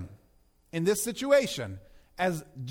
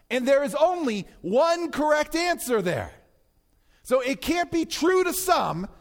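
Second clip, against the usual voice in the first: raised, insistent sermon voice; higher-pitched, forceful pulpit delivery; emphatic preaching; 5 semitones higher than usual. Real, not acted. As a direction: drive the argument home to the congregation.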